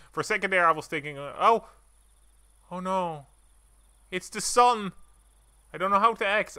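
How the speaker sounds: high, loud hammed-up panic; playful fake alarm over the game; mock panic. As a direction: yelp it like a cartoon scare.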